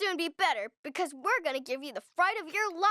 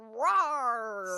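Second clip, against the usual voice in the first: low growling roar; monster roar; 10 semitones lower than usual. Acted. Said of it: "I'm scary".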